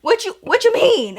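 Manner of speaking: mock shocked question; baffled question. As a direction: squeak it out in disbelief. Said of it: "And I was like".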